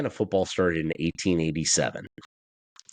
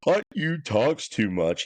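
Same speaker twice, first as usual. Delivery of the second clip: slow, higher whiny mimic; mocking rival fans; 6 semitones higher, slower than usual. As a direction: whine it out like a rival fan. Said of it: "Ohio State fans are all out here talking about".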